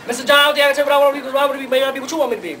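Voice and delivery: rattled off very fast with sass; fast, sassy ten-year-old girl; sassy rushed talk. Retold or imitated. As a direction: rush it out, sassy and quick. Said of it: "if you don't slow your little sassy ass down".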